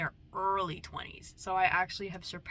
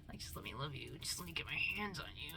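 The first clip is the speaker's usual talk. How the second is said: whisper; 4 semitones lower, 6 dB quieter than usual. Pretend. Whispered creepy affection.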